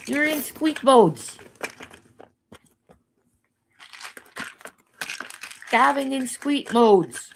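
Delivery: high, sing-song voice for the dog; pet baby talk. Acted. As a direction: coo at the puppy like a baby. Talking to her dog.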